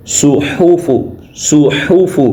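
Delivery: صحف with a breathy ha brought out plainly; clear ha sound. Shown as a demonstration. Teacher drills the ha sound for pupils. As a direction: push the ح in صحف out from the throat. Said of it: "ہا کی آواز کو نکالنا ہے".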